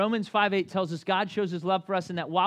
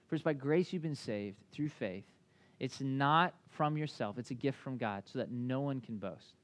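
scripture reading; 5 semitones lower, 8 dB quieter than usual. Acted. Reads scripture reverently.

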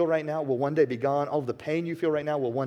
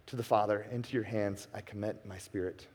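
much softer and lower, fading out; final breath; 7 semitones lower, 9 dB quieter than usual. Acted. Jesus on the cross with his last breath, soft and low. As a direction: let the words die away on a last breath. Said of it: "in his final breath".

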